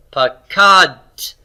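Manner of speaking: "pakad" with high, long KAD and released t; stress on root two. Shown as a demonstration. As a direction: model "pakad" as pa-KADT. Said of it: "It's on root two".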